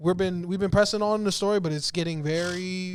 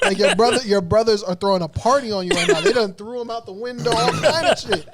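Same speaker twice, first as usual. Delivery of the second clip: loud, higher, laughing retelling; 4 semitones higher, 6 dB louder than usual. Real, not acted. Streamer telling viewer his brothers beat Robo, laughing loudly. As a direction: holler it through laughter.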